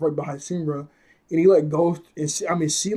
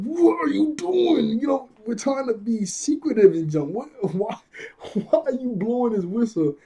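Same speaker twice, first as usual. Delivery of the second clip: mock exasperated friends; 7 semitones higher than usual. Team scolds whistle blower.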